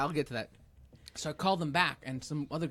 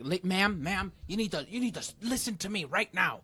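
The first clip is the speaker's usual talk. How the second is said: imitating a pushy scammer, raised and insistent; higher-pitched, badgering mock demand; mean scammer; 5 semitones higher than usual. Scammer bullying an old person on the phone, mean and insistent. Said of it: "they're mean".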